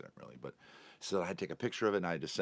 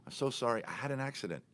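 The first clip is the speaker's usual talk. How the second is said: imitated higher-pitched apology; apologetic; 8 semitones higher than usual. Driver apologizing to passenger for the delay, apologetic. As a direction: retell her contrite apology in a raised pitch. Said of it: "and she apologized".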